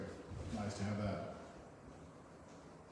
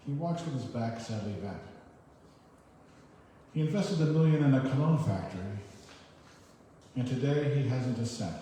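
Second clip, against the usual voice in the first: louder comic verse delivery; poem reading; 3 semitones higher, 5 dB louder than usual. Acted. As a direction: read the punchline verse for laughs. Continues the poem.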